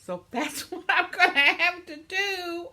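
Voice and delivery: high, loud laughing through the words; giggling lament about chores. Real, not acted. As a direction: laugh it out, nearly crying. Laughing about cleaning house.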